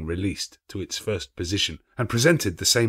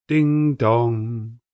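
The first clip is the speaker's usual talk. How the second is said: ding dong rung out loud and long like a clock bell; chiming bell; 4 semitones higher, 5 dB louder than usual. A narrator's reading. Narrator ringing out the clock chime, loud and sustained. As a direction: sound it like the tolling bell.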